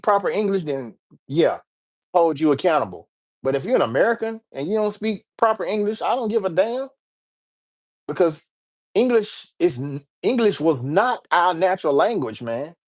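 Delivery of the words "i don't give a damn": normal talking voice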